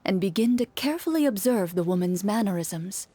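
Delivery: calm, even storyteller read; measured narrator voice; story narration. A narrator's reading. Narrates the scene.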